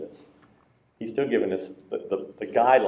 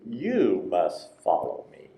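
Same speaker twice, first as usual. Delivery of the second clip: slow, with "You" drawn out and pitched up; stress on You; 3 semitones lower, slower than usual. Shown as a demonstration. Preacher bringing Jesus's words home, slow stress on "You". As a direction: stretch "You", then spell out the rest slowly. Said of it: "I emphasize the word".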